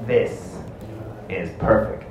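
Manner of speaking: low, soft, measured murmur; understated secret satisfaction; hushed scheming. Acted. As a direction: say it quietly to yourself, pausing after the first word. Repeats Charlie's secret reaction.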